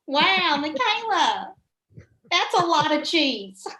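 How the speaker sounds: high, amused pageant host aside; impressed host ad-libbing; pageant announcer. Retold or imitated. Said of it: "whatever lady was introducing me".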